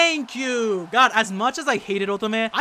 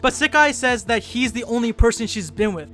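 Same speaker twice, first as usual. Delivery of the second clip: ordinary talk, nothing marked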